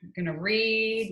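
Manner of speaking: ordinary delivery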